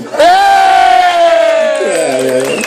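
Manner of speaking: high shouted yeah to the crowd. Real, not acted. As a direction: whoop it up, rallying the room. Best man whoops to the crowd.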